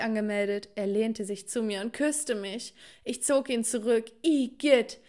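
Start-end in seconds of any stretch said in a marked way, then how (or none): 4.2-5.0 loud, higher cry of disgust; sudden yuck after a kiss, loud and high. Acted. Recoils from a fish-breath kiss.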